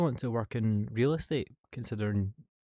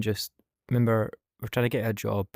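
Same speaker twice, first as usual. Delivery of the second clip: ordinary delivery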